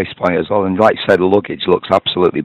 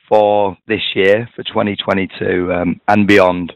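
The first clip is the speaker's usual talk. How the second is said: normal talking voice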